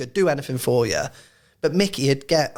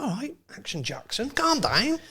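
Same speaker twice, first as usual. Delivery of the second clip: high, soft, teasing lilt; mock soothing tease; 8 semitones higher, 5 dB quieter than usual. Physio winding up the serious coach, mock soothing. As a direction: wind him up in a small high voice. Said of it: "me, he'd be like".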